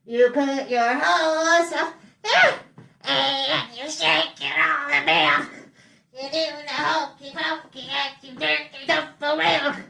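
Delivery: goofy nose-pinched singing. Pretend. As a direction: sing holding your nose shut. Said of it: "I'm gonna sing it in a really goofy voice".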